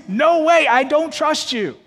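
high, loud mock scream of a child. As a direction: scream the refusal like a scared kid.

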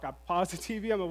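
ordinary talk

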